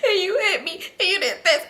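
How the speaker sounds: high mock sobbing, listing wrongs; tearful accusing. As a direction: whine it out through pretend tears. Retold tearful accusations while crying.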